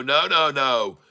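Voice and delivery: usual speaking voice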